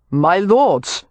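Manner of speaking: relaxed, reasonable gentleman's address; measured appeal in a character voice; excessively reasonable. A narrator's reading. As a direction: sound patiently reasonable to brawlers. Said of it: "in an excessively reasonable tone".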